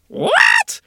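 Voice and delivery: very high shocked shriek. Acted. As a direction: shriek in outrage. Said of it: "shrieked the governess".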